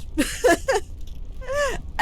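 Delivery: high, loud burst of laughter; real high-pitched laugh at disaster; loud despairing laugh. Real, not acted. Player who just died, loud despairing laugh. Laughs after dying in game.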